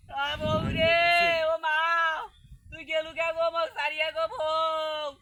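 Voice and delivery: hammed-up lament for a dead wife; theatrical crying. Resumes wailing for dead wife.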